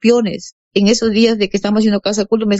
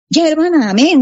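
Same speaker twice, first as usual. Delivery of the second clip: cheerful agreement; 7 semitones higher than usual. Retold or imitated. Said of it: "me dijo".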